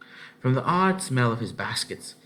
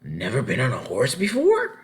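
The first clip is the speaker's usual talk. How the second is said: surprised; 3 semitones higher, 4 dB louder than usual. A narrator's reading. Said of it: "cried the man in surprise".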